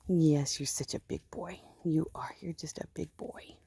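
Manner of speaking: soft, whispered pet talk. Real, not acted. Fussing over her big ram, she coos a whispered compliment. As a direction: coo it to the ram like sweet nothings.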